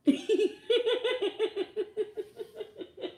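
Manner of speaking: loud high burst of laughter; shrieking laugh; high cackle. Real, not acted. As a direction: crack up hard. Laughing at his own story.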